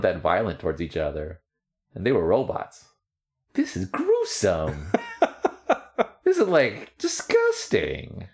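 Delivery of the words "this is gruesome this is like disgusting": disgusted. Real, not acted. Disgust at violent cartoon.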